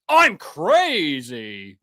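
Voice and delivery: "I'm crazy" drawn out, very slow; slow dry sarcasm. Pretend. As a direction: drag "crazy" out dryly. Repeats the phrase, drawn out.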